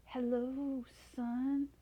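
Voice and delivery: a hint of a hissing dragon father; lightly voiced dragon greeting his son. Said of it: "opened one eye and hissed".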